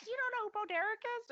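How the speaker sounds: high, fast incredulous question; mock disbelief. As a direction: fire it off in shocked disbelief. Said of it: "a bunch of us went".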